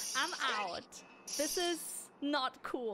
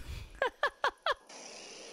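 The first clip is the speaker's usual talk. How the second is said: giggle; 11 semitones higher than usual. Real, not acted. Laughing at game scene.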